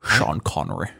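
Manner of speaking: low Scottish film-star impression; Sean Connery. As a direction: drop low for a famous actor impression. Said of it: "maybe Sean Connery. You have that deep voice".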